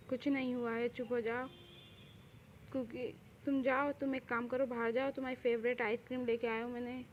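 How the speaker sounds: soft, hushed reassurance; gently calm someone who is crying; soothing comfort. Acted.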